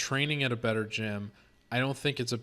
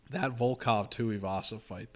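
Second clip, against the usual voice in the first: usual speaking voice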